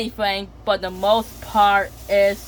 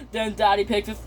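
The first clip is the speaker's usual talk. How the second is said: ordinary delivery, nothing marked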